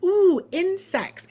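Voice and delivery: put-on high, slow, delighted squeal of a child; mock kid excitement. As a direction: coo like a fascinated little kid. Kids imitated with excitement.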